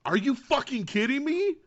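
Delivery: high, loud disbelieving cry; thrilled shout of disbelief; excited outburst. Real, not acted. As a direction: burst out in real excited disbelief. Outburst at Harrison Ford cameo.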